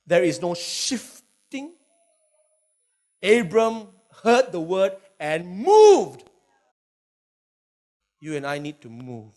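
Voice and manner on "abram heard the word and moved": slow, louder declaration; emphatic proclamation. Acted. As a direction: proclaim it slowly to the crowd. Preacher drives the point home.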